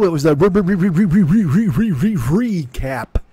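playful stuck-syllable trill; comic stutter. Pretend. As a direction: stick on the first syllable like a skipping record. Comic stuck-record stutter on recap.

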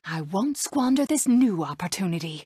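normal talking voice

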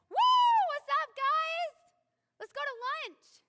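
very high, loud, slow, chirpy party voice; overly cheerful buddy. Retold or imitated. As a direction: ham up a friendly dude inviting everyone out. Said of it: "he's like".

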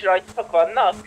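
ordinary talk, nothing marked

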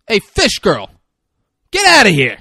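loud, high holler from the stands; heckler's yell. Retold or imitated. Cigar-smoking man in bleachers to mascot, heckler's yell. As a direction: bellow it to chase someone off.

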